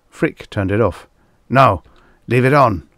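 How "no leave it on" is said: raised, loud groaning order; moaned. A narrator's reading. Producer to his assistant at the screen, moaning. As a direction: moan it with some force. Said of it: "the producer moaned".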